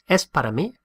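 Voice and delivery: "Es para mí" rising into a question; teacher shows a question by tone alone; question tune. Shown as a demonstration. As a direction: lift the pitch at the end of "Es para mí". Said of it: "Just change the tone of our voice".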